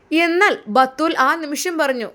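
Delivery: narrating the wife's answer; story narration. A narrator's reading. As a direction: build up to her reply. Narrator scene setting.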